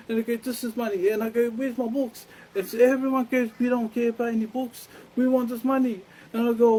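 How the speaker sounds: high, loud back-and-forth quarrel; agitated retelling. Retold or imitated. Acting out quarrel over missing books.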